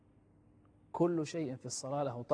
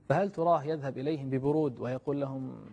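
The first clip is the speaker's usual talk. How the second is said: ordinary delivery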